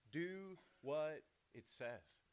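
slow, word-by-word command; measured, weighty delivery with drawn-out words; deliberate emphasis. Real, not acted. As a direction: spell out the command slowly. Preacher stresses a quoted command.

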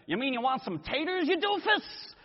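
high-pitched country bumpkin sneer, rising sharply on doofus; mock the polite guest like a backwoods yokel. Rude reply at a dinner table.

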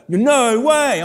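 high, loud "No way."; shocked disbelief. Retold or imitated. Stranger learning the speaker is a pastor, loud high disbelief. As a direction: blurt it out, floored by the news.

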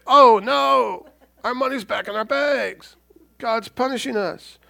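high, loud "oh no", then slow dread; mock dismay. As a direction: brothers horrified by the money. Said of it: "They're like".

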